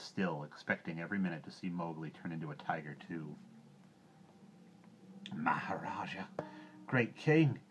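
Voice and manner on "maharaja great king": awed, husky stage whisper rising in force; hoarse whispered address swelling louder. A narrator's reading. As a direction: half-whisper it huskily, then push harder. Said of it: "in a husky whisper".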